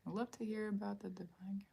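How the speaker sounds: soft unvoiced whisper. Real, not acted. Reader whispering a request to the cards. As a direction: murmur the wish under your breath.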